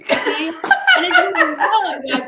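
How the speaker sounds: drawn-out laughing, high and loud. Real, not acted. Guest laughs at host's joke about signing up, drawn-out laughing. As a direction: stretch it out through a big laugh.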